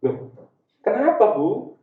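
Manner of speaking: normal talking voice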